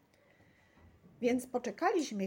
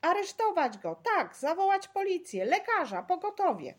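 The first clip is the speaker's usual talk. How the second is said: high, loud urgent demands; clamouring bystanders calling for help; crowd clamour; 10 semitones higher, 7 dB louder than usual. A narrator's reading. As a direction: shout orders from an agitated crowd. Angry crowd calling for police, doctor.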